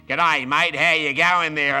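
loud, drawn-out mock Aussie greeting; broad Australian accent. Customs officer greeting a returning traveller, imitated broad Australian accent. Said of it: "I go through customs and I hear this".

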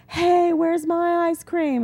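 put-on high, slow whiny kid voice; indignant child demanding ice cream; complaining child. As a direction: whine like a kid who got left out. Child who missed the instructions.

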